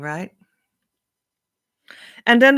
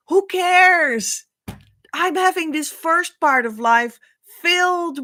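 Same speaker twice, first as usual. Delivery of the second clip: a high, loud scoff; scoffing dismissal; 8 semitones higher, 5 dB louder than usual. Acted. Someone enjoying life scoffs at twin flame talk. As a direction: brush it off with scorn.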